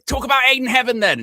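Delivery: high, loud mock interviewer. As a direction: push the question like a pundit. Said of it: "You go".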